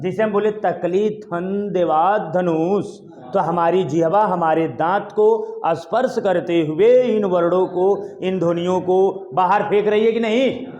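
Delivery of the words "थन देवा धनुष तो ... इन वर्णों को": normal talking voice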